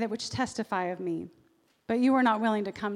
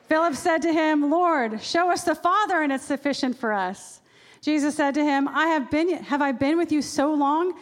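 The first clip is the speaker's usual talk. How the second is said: raised, dramatic reading; 5 semitones higher, 5 dB louder than usual. Acted. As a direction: read it loud and high, full of feeling.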